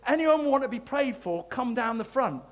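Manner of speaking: lightly imitated preacher's altar call; J John. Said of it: "he just goes".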